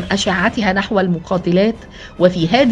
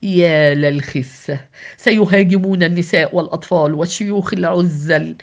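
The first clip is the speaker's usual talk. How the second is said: plain reading voice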